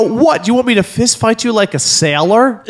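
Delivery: mincing condescending mock; mocking his wife with a prissy sneer.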